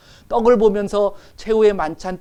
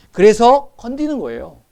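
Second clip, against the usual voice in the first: emphatic repeat; 6 semitones higher, 7 dB louder than usual. Real, not acted. Preacher repeats point emphatically.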